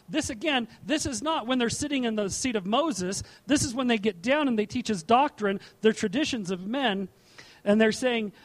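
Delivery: normal talking voice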